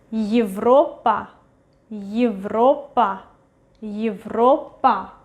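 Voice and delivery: Европа with Е as a short yi, stress on the o; reduced initial Ye. Shown as a demonstration. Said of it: "we read it as Y".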